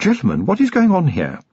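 high-pitched lady of the house demanding an explanation; commanding lady. A narrator's reading. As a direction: interrupt as a lady in charge. Wife interrupts the armed standoff.

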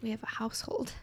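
mock-solemn whisper. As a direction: hush it like a secret. Teasing official-sounding phrase.

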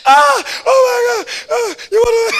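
high shriek of laughter; squealing with laughter; laughing shout. Real, not acted. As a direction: cry it out mid-laugh. Preacher overcome with laughter.

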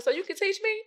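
very high, astonished question; shocked grandmother. Retold or imitated. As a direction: imitate a grandmother's amazed, eager question. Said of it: "she was shocked".

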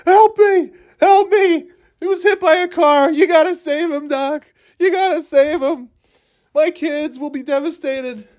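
very high falsetto cries for help; hammed-up squeaky pleading with the vet; frantic pet owner. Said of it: "has to take it to the animal hospital".

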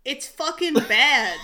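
slow, loud, laughing; laughing verdict. Real, not acted. Friend laughs out a movie review. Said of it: "How was it?".

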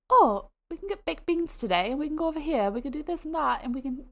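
high-pitched imitation of a British grandma. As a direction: chatter on like a British granny. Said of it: "just talking all her British stuff".

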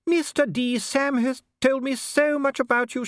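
high, gushing Duchess trill. A narrator's reading. Duchess charming a dwarf official, trilling. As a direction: trill it sweetly, pitched right up. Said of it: "she trilled".

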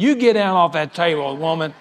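teasing loud rebuke; mock scolding shout. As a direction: bark it at her like a stern husband, half joking. Scolding his wife on the cruise.

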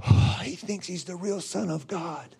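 low, hushed imitation of scandalized gossip; softly mimicked disbelief; mocking scoffers. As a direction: imitate accusers murmuring with a mock gasp. Mimics the accusers murmuring about Jesus.

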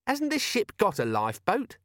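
high-pitched, hasty question; hurried. A narrator's reading. Cheery changing the subject aboard ship, hurriedly. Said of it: "said Cheery hurriedly".

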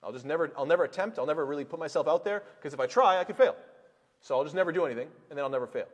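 hammed-up voice of a timid quitter; mock fearful excuse-maker. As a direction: play the scared person talking themselves out of trying. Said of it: "take this to the extreme".

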